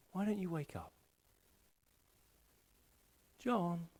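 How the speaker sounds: very soft, tender and coaxing; barely above a breath, lovingly waking someone; tender hush. Acted. Jesus breathing on sleepers by name, tender near-whisper.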